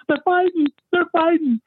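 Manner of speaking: very high mimicked frantic cry; panicking wife. Wife reporting a family fight by phone, panicking. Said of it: "she's, she's panicking".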